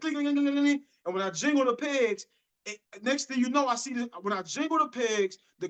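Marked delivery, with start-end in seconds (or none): 0.0-0.8 quick, high run of imitated metal clinks; metallic jingling. Shoe shiner imitating his foot pegs jingling, high metallic clinking. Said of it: "got this little metal sound".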